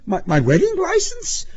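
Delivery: very high stammered question; bewildered, high-pitched disbelief; amazed cry. A narrator's reading. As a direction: squeak it out in astonishment.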